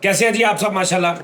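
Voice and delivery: bright upbeat host greeting; host welcome. Real, not acted. Host greets viewers on show.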